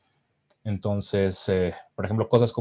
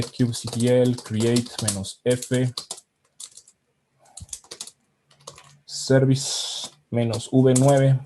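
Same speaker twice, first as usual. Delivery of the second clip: slow, spelled-out command dictation; much slower than usual. Real, not acted. Presenter types a command for viewers, slow dictation. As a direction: read it out slowly as you type.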